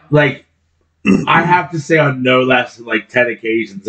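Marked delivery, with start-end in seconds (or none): none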